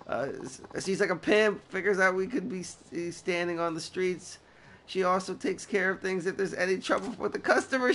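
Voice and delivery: high, lightly put-on girl voice; schoolgirl voice. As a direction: play the frightened schoolgirl in falsetto.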